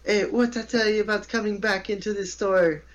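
high mock yell at a man; lady yelling. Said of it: "this lady loud, real loud".

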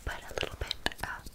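ordinary talk

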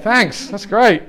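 slow, dry mock gratitude; sarcastic thanks. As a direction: drag it out, deadpan sarcasm. Sarcastic reply to mock confession.

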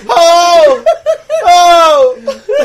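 high, loud mock wails of horror; imitating screaming woman. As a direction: cry out over and over, hammed-up. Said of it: "and she's still going".

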